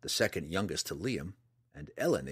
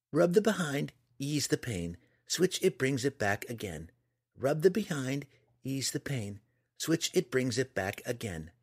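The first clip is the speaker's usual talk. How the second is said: higher-pitched singsong recitation; repeated litany; 6 semitones higher than usual. A narrator's reading. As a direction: chant it to yourself as a distraction from pain. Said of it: "repeated over and over, a litany".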